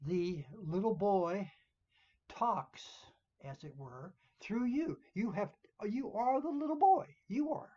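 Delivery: rising urgency, pressing the point home; emphatic, building. Shown as a demonstration. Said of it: "you have to change personality".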